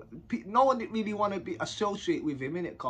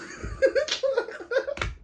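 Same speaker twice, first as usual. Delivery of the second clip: high, far laugh; shrieking laugh; high burst of laughter. Real, not acted. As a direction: break into real laughter. Laughing at the memory.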